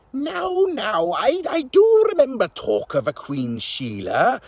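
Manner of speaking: put-on high old witch, thinking aloud; musing witch. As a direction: muse like an old witch recalling. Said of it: "the witch mused".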